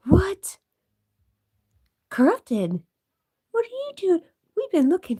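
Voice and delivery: high, loud, startled cry to the cat; a real gasp of shock at the returning pet; surprised greeting. Real, not acted. Streamer spots her missing cat Corrupted, loud surprised cry. Cat reappears after long absence.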